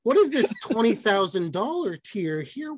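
lightly mock a baffled comedian; Jim Gaffigan impression. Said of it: "that was my Jim Gaffigan impression".

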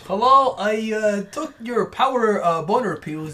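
vaguely Danish foreigner, hesitant; Danish-sounding accent. Acted. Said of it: "You sound Danish".

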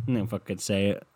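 usual speaking voice

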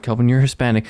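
normal talking voice

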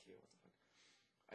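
slow whispered inner reaction; bewildered whisper. Retold or imitated. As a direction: whisper it slowly, at a loss. Retold bewildered thought mid emergency.

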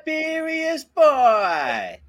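high, slow drawn-out yell; drawn-out cheering call; hype shout. Acted. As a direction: stretch out the shout like cheering a buddy on. Hyping a friend's name.

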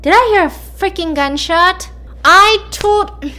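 loud, high-pitched indignation; acted outrage. Brother hears a gunshot he forbade, outraged. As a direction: burst out high and loud, incredulous and cross.

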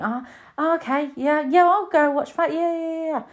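high, bouncy yeah yeah yeahs; playing along like it's a treat; mock eager agreement. Imitating herself keen to watch, high and eager. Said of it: "and you think".

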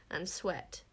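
plain reading voice

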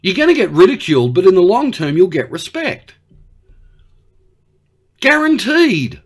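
high, loud insistent promise; emphatic assurance. Acted. Australian promising Xi respect in the end, emphatic.

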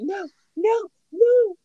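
imitating a terrified daughter; mock scared child. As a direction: wail like a frightened little girl.

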